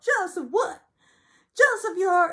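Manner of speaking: high, loud outburst; high mock screech; mock yelling Sutton. Sutton set off.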